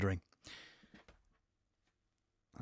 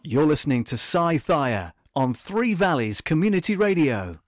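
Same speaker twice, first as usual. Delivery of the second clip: loud, upbeat station ident; radio announcer; 3 semitones higher, 9 dB louder than usual. Acted. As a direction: punch it like a radio jingle.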